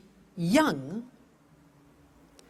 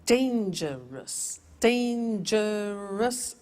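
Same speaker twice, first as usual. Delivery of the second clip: Dangerous spoken very slowly, beat by beat; slowly counted syllables; much slower than usual. Shown as a demonstration.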